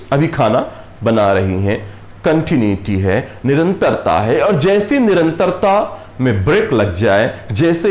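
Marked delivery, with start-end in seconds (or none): none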